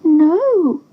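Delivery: high, small duckling voice. A narrator's reading. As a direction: answer like a timid duckling. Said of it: "the duckling told her".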